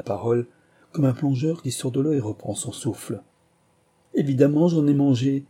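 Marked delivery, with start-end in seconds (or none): 4.1-5.4 higher, louder outcry; raised-pitch exclamation; exclaiming. A narrator's reading. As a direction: cry it out as if stating the obvious. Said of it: "s'écria-t-il".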